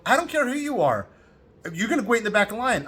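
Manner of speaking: heated voice, refusing to budge; retold angry retort. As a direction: put the cutter in his place. Confronting line cutter in Pompeii.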